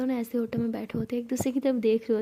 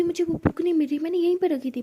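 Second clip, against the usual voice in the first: higher and louder, mildly frantic; agitated searching; 6 semitones higher, 4 dB louder than usual. A narrator's reading. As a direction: voice lifted in worry over something lost. Frantic search for missing book. (परेशान, घबराई आवाज़)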